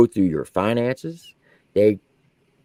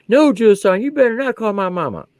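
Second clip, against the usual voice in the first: high, pleading private's voice; whiny soldier voice; 9 semitones higher than usual. Retold or imitated. Drill sergeant mimicking his private, high and pleading. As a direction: squeak it like a scared recruit.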